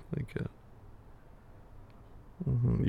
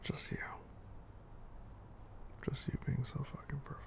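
sweet nothings; 10 dB quieter than usual. Acted. Tender whispered praise.

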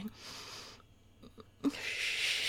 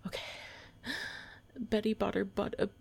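normal talking voice

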